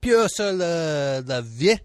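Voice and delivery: Peur sur la said slowly, then la vie, a faltering bad French accent; self-conscious fake French; second French attempt. Shown as a demonstration. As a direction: stumble through Peur sur la vie again. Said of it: "I'm not good at accents".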